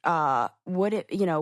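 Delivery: ordinary talk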